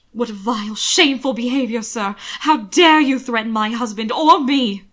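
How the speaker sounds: loud, indignant scolding; outraged rebuke to the attacker; indignant outrage. Acted. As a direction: dress him down loudly. Will scolds attacker loudly.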